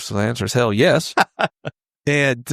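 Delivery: normal talking voice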